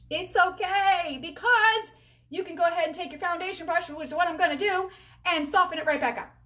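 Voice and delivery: reassuring. Real, not acted. Reassuring viewers about too much blush.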